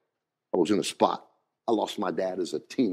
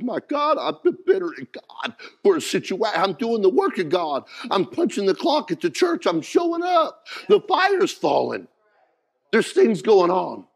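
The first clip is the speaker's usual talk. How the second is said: rising higher and louder into a shout; full-force preaching; 10 semitones higher, 5 dB louder than usual. Acted. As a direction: build the sermon to a roaring peak. Climactic preaching realization.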